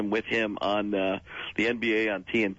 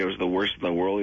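normal talking voice

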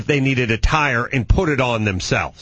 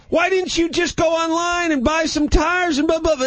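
much higher, exasperated disbelief; high incredulous. Retold or imitated. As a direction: squeak it out in disbelief. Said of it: "my voice went up like twenty-eight octaves".

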